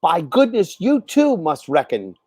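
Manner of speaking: ordinary delivery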